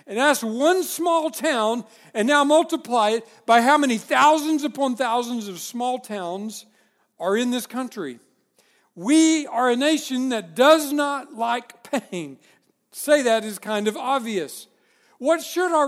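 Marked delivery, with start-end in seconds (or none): 0.1-1.8 slow, higher, louder emphasis on "one small town"; emphatic. Real, not acted. Preacher to congregation, slow emphatic stress. 9.0-12.4 slow, louder, higher stress on "does not like pain"; emphatic declaration. Real, not acted. Preacher to congregation, slow hammered emphasis.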